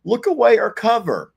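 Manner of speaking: high, loud mock scolding; playful scolding. Real, not acted. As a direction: shoo her off camera, teasing. Scolding partner for yawning on camera.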